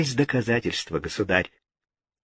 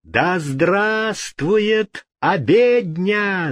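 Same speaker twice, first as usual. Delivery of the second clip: much higher, slow ringing acclamation; crowd cheer; 8 semitones higher, much slower than usual. A narrator's reading. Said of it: "закричали придворные".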